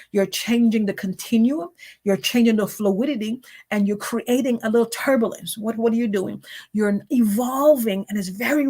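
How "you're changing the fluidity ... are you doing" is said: ordinary talk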